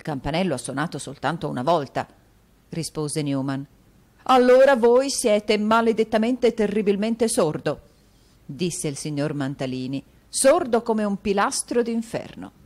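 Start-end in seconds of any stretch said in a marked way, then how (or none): 4.2-7.8 a vain fop fuming theatrically; foppish Mantalini. Acted. Dandy Mantalini cursing at the door bell, exasperated. 10.3-12.5 a vain fop fuming theatrically; foppish Mantalini. Acted. Dandy Mantalini cursing at the door bell, exasperated.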